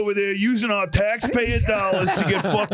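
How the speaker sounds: high, laughing Long Island guy; brash Long Island accent impression. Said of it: "That's our Long Island impression".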